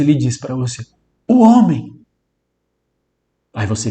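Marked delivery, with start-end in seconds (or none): 1.3-1.9 loud, high two-word burst; stutterer's blurt. Acted. Teacher acting a Portuguese-speaking stutterer to the listener, loud blurt. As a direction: blurt it out abruptly, much louder and higher. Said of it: "Agora, um gago, falante da língua portuguesa".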